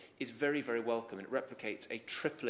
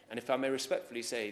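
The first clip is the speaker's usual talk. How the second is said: usual speaking voice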